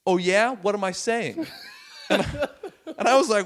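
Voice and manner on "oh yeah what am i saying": raised, challenging retort; defiant heckler. Retold or imitated. Talker in front row challenging the comic, defiant. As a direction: imitate a lippy guy daring the comic. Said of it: "But the guy goes".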